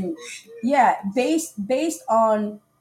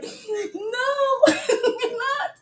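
high, slow laugh-cry; laughing through tears; 13 semitones higher, much slower than usual. Real, not acted. Retelling the awful date to her friend, laughing through tears.

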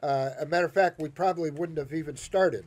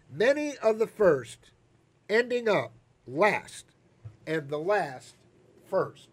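measured sermon reading voice; scripture reading; 4 semitones higher, 5 dB louder than usual. Acted. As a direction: read the parable to the congregation. Reads the parable aloud.

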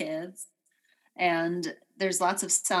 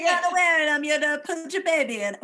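put-on much higher, louder, laughing Danish accent; badly faked Danish accent, high and laughing; 10 semitones higher, 5 dB louder than usual. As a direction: crack up mid-accent. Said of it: "miss an accent by such a wide margin".

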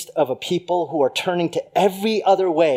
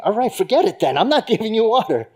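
higher, louder fed-up snap with a laugh; mock indignant refusal, louder and higher; exasperated refusal; 8 semitones higher, 4 dB louder than usual. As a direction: throw up your hands, half laughing. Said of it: "I'm saying".

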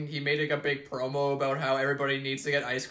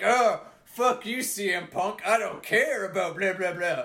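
mock wrestling heel; 4 semitones higher than usual. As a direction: imitate a heel trash-talking, trailing into dismissive blahs. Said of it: "having a heel come out and being like".

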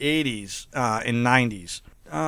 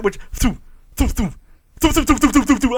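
repeated "thoo" shot noises, higher and louder; mock gun noises "thoo thoo"; dart shot sounds; 9 semitones higher, 4 dB louder than usual. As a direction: fire off "thoo" like tranquilizer darts. Imitating sedative shots.

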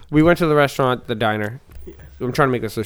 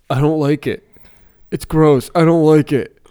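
mock picky kid, a bit louder; whiny kid; 5 semitones higher, 8 dB louder, slower than usual. Picky kid refusing lasagna, whiny. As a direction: whine it like a fussy kid. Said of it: "He didn't try it, nothing".

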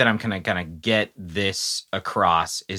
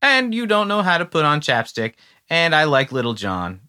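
higher, louder sneering mimic; mocking the singer; 6 semitones higher, 4 dB louder than usual. Podcaster mocking the singer's lyric, higher put-on voice. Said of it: "the next thing he's just like".

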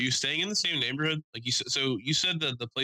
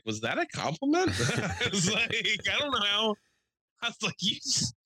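laughing disbelief; 9 semitones higher than usual. Retold or imitated.

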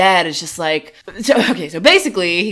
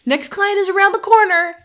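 cheery Emma; 11 semitones higher than usual. Retold or imitated. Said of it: "to say, like".

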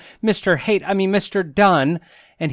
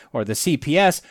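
ordinary talk, nothing marked

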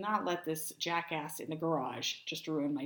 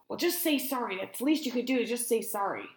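raised, higher-pitched demand; retold yell; 5 semitones higher, 5 dB louder than usual. Driver demanding an apology from woman, mild yell. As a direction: imitate an irritated man yelling out. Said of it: "he sort of yells out".

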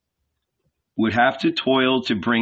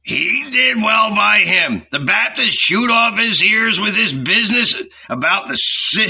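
ranting innkeeper; 7 semitones higher than usual. A narrator's reading. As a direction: sneer and shout him down.

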